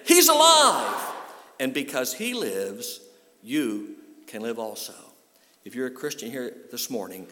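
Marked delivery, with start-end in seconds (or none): 0.1-1.1 much higher, much louder proclamation; preacher shouting the good news; triumphant shout. Acted. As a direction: shout it to the rafters. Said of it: "Really say it again".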